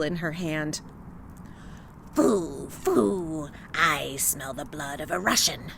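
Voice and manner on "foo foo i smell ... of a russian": low, growling witch sniffing out prey; old witch voice. Acted. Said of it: "she cried".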